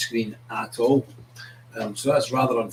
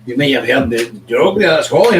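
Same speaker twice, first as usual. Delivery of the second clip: usual speaking voice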